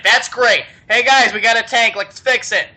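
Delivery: loud, high, acted-out delight; rally the troops with hammed-up glee; excited. Acts delighted commander rallying men.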